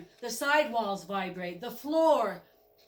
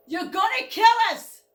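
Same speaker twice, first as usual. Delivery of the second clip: much higher and louder terrified yell; panicked scream; 12 semitones higher, 10 dB louder than usual. Acted. Goat screams at the driver as the boiler swells, panicked. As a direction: scream that the boiler will blow.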